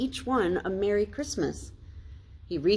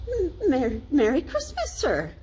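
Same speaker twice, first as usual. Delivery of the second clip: higher, stumbling surprise; stammered greeting, pitched up; stammering; 8 semitones higher, 4 dB louder than usual. A narrator's reading. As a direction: trip over the words in shock. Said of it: "he stammered".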